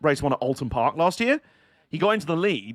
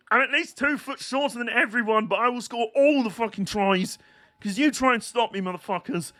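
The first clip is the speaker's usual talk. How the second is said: higher-pitched mock boast; Jason Robinson; 6 semitones higher than usual. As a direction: put on a cocky little-guy voice, pitched up. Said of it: "He didn't talk like that".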